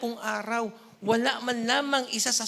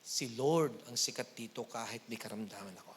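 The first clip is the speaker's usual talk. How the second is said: much lower, softer defiant declaration; defiant reply; 12 semitones lower, 8 dB quieter than usual. Pretend. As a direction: quietly reject the devil. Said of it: "sabihin mo".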